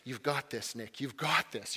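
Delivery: softly coaching himself, a touch hushed; calm yourself down under your breath; murmured pep talk to himself at the line; quiet self-talk. Retold or imitated. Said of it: "you have that self-talk".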